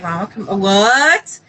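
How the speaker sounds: stunned echo ending on a questioning upswing; baffled double-take, acting it out; retold disbelief. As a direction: repeat it, breaking into a rising "what?". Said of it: "We were in disbelief".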